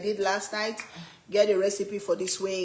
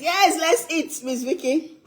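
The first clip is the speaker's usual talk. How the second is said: eager; 8 semitones higher than usual. Real, not acted. Eager to start eating.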